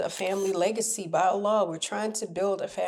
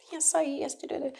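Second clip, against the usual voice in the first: high, quick imitation of the other person; a lightly voiced stranger insisting; mock acquaintance; 9 semitones higher, 3 dB quieter, faster than usual. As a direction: play the acquaintance, fast and pitched up. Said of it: "they'll say".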